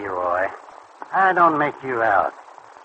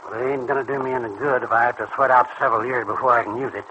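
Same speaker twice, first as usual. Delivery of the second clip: usual speaking voice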